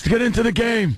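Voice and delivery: high, strained imitation of a wrecked gravel voice; quick mock of the Godfather's voice; Godfather's gruff voice. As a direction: put on the Godfather's screwed-up voice. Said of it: "Is his voice really screwed like that".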